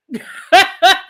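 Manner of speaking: hearty laugh breaking out; loud laugh. Real, not acted. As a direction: let out a loud, real laugh. Laughing at Naomi's antics.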